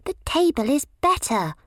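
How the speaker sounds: high, insistent little protest. A narrator's reading. Violet bickering with Primrose over the table, high protest. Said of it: "protested Violet".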